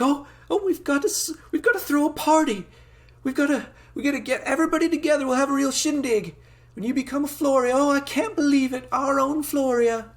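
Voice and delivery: high falsetto country mother, thrilled and loud; folksy high-pitched mom gushing with excitement; excited farm mom. Acted. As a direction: bubble over like a proud farm lady. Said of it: "his mother's like".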